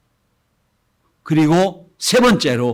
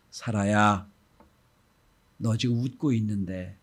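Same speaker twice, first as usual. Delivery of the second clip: soft, low, tender reassurance; gentle and understanding; 3 semitones lower, 3 dB quieter, slower than usual. Acted. God speaks tenderly to Sarah, softly and kindly. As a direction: speak softly, like a smiling father. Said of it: "얼굴에 웃음을 쫙 띄우면서".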